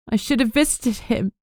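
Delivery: fiancée speaking through sobs; broken between sobs. Acted. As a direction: grief catching in the throat. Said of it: "she said brokenly between sobs".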